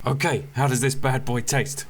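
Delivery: ordinary talk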